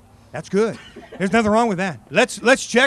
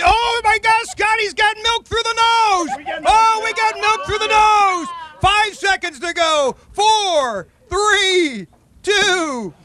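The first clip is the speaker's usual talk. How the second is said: excited shout; 9 semitones higher, slower than usual. Real, not acted. Announcer shouting as milk comes through the nose. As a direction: yell it like the game's on the line.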